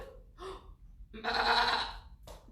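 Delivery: breathy gasping goat noise; goat bleat. Shown as a demonstration. Showing kids what a goat sounds like, gasped goat noise. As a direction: imitate a goat with a sharp gasp. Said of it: "That's what a goat sounds like".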